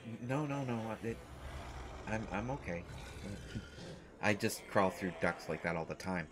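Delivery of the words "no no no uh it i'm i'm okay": ordinary delivery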